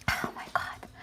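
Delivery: breathy unvoiced whisper to herself; a hushed oh my God; whispered aside. Real, not acted. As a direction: drop to a whisper, overwhelmed.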